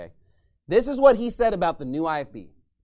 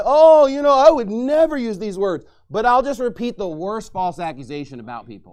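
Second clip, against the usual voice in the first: louder mock pious voice; holier-than-thou; 8 dB louder than usual. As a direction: parody a smug preacher. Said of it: "have this holier-than-thou attitude and say".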